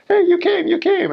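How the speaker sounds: very high, delighted squeal; excited welcome. Retold or imitated. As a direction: call out overjoyed, way up high. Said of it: "starts waving at us like".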